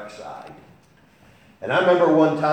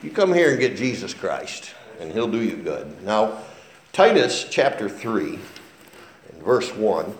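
ordinary talk, nothing marked

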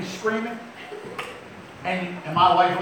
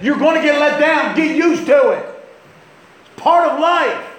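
louder, higher, "gonna" punched; emphatic warning; 8 semitones higher, 6 dB louder than usual. Acted. Preacher warning the congregation, emphatic and loud. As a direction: drive the warning home.

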